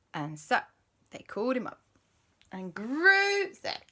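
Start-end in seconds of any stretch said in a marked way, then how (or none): none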